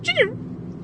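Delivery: high squeaky ching; squeak imitation. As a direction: mimic a tiny squeak toy. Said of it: "just be like".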